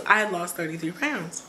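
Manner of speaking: normal talking voice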